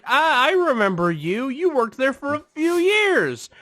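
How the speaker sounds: high-pitched imitation, rising in excitement; mock excited recognition. Man recognizing deli worker, high mock excitement. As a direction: gush it high as the customer.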